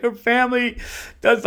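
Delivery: high, loud imitation of weeping; mock breakdown. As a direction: act out a man breaking down. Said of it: "this dude broke down".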